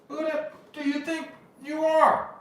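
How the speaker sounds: high-pitched mock indignation; outraged retort. Dude rebuffing a man who demands listening, outraged. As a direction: act out an affronted comeback. Acted outraged reply to the pleader.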